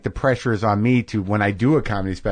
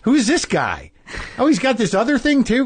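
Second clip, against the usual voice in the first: higher-pitched, puzzled onlooker imitation; mock incredulous stranger; 12 semitones higher than usual. People who don't know the comic, mock incredulous. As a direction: question it as a baffled newcomer.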